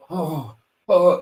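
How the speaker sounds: hammed-up pained groans; wrestling selling groans. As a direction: sell it like a hurt wrestler. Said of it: "proper wrestling selling".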